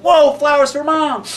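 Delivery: high, loud joyful shout; delighted kid. Acted. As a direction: cry out in wonder. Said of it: "And Willie went".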